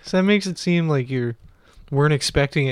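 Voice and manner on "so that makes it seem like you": normal talking voice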